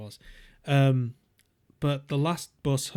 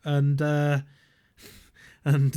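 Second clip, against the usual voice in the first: normal talking voice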